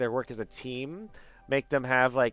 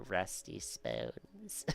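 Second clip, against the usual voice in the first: put-on creepy old-lady rasp, drawn out; light impression of an eerie, slow cartoon voice; Salad Fingers; 5 semitones lower, 12 dB quieter, much slower than usual. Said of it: "sounds like salad fingers".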